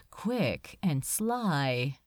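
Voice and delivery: usual speaking voice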